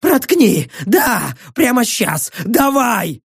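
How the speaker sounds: high, urgent cries; desperate begging. Acted. Prisoner begs for the knife, frantic. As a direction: urge him on in high bursts.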